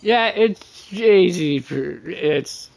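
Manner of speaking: ordinary delivery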